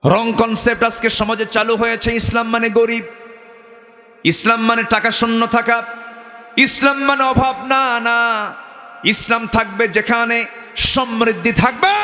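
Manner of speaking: high-pitched sermon delivery; preaching. Acted. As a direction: preach it high and insistent to the congregation. Preaches in raised voice.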